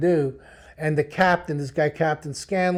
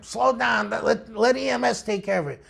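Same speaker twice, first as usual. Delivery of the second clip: mock argument; 5 semitones higher than usual. Partner arguing in the car, mildly heated. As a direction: keep nagging, half annoyed. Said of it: "We're arguing the whole time".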